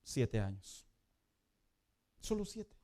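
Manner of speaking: ordinary talk, nothing marked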